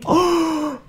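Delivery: high-pitched "oh" of delight; astonished high cry; excited high squeal. Real, not acted. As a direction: squeal at a thrilling surprise. Thrilled by card reveal.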